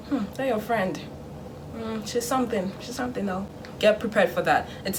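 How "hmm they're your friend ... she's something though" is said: softened, insinuating verdict; judgmental parent. Acted. Parent judging your friend once they leave, insinuating. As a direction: imitate a parent quietly passing judgment. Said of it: "they will always judge your friend".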